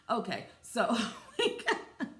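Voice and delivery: words breaking into laughter; laughing. Real, not acted. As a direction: lose it mid-sentence. Breaks up laughing mid-sentence.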